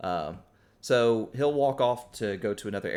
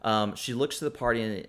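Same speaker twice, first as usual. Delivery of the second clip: usual speaking voice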